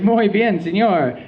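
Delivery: a pleased, simple 'muy bien' in a hammed-up Spanish accent; mock Spanish accent.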